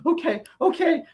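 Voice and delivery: high, anxious double okay; flustered waitress. Retold or imitated. Waitress caught between two men, high and anxious. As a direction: squeak out a frightened okay. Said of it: "And she's like".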